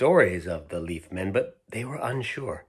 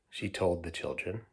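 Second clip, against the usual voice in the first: ordinary delivery, nothing marked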